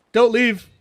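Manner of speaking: calling out loud. Real, not acted. Calls out to chat.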